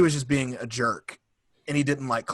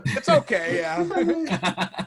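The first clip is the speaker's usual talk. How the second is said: much higher, laughing through the words; giggling it out; much slower than usual. Real, not acted. Answering the Jar Jar fan, laughing much higher.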